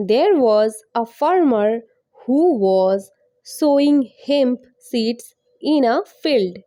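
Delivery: very slow, separated English words; slow teaching pace. Shown as a demonstration. Teacher reading story line to learners, very slowly. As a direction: model each word slowly for copying. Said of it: "অনেক আস্তে আস্তে".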